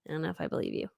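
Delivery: small, low, soft reply; doubtful. Retold or imitated. Retold doubtful reply to mom.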